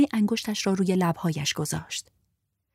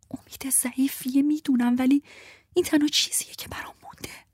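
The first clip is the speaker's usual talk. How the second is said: sad whisper; 8 semitones higher than usual. Acted. Desperate whispered hope.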